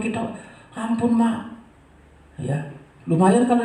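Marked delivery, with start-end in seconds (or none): 0.8-1.5 imitated plea, pitched higher; child begging mercy. Child to mama during ear-pulling, imitated pleading.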